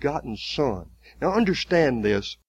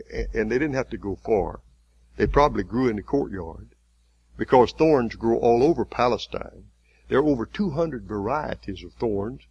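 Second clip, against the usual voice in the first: ordinary talk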